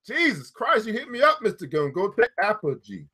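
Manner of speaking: normal talking voice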